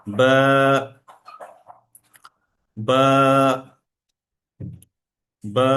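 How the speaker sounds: বা with a light, short lengthening of the vowel; short stretch on ba. Shown as a demonstration. Teacher shows the short drawl on বা. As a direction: hold the আ of বা just a little. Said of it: "স্বল্প টান দিতে হবে".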